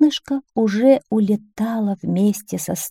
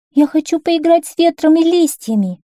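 put-on high-pitched child's wish; wishful princess voice, pitched high; eager little princess; 8 semitones higher, 4 dB louder than usual. A narrator's reading.

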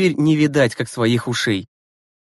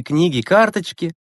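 usual speaking voice

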